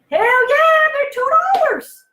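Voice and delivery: high, loud, changed voice of a keen mom; eager mom. Retold or imitated. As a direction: mom cheering yes at the shelf. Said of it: "And the mom's like".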